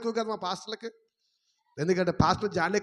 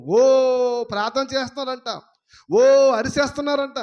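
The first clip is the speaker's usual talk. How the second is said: scornful mock; 5 semitones higher, 6 dB louder than usual. Mocking loud prayers.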